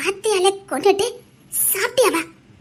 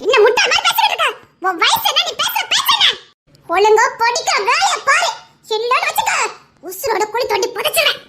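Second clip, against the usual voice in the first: higher, fast angry scolding; angry mother; 5 semitones higher than usual. Acted. Mother threatening her daughter, fast angry scolding.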